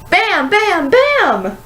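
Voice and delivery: high, punchy bangs; excited sound words. A narrator's reading. Narrator voices falling planets, high and punchy. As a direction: pop each bam up high. Balls fall, sound effect.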